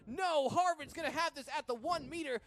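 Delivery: loud, high-pitched breakaway call; excited play-by-play. Real, not acted. Commentator calling a runner splitting the defenders, excited. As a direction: build the thrill as the runner breaks clear.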